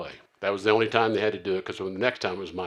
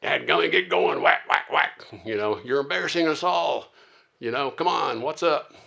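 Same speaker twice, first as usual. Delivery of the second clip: loud, high mock scolding; whip-cracking leader; 7 semitones higher, 6 dB louder than usual. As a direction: act out a bullying leader driving the crew on. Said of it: "come back and take the whip out".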